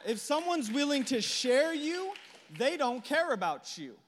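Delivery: high, slow, lifting someone's; emphatic preaching. Real, not acted. Preacher driving a point about love to the church, high and emphatic. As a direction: build it up strong. Repeated line, building.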